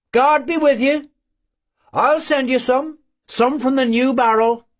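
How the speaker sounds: a loving farewell at the gate; voiced old mother and son.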